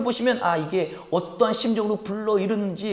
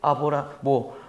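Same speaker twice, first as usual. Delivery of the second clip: mock polite reading; 7 semitones lower, slower than usual. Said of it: "이렇게 정중하게 아주 그냥 이렇게".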